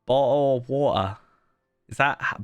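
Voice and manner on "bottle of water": slow, drawn-out British "Bottle of water."; mock British "Bottle of water."; slow British accent. Shown as a demonstration. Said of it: "I have to say that".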